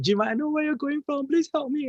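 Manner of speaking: high pleading mother voice. Retold or imitated. Mother begging Jim for help, high and pleading. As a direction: beg like a desperate rich mother.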